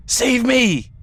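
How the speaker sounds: very high, loud cries of alarm; panicked yelling. A narrator's reading. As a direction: yell for your life in a shrieking crowd. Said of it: "began to yell at each other".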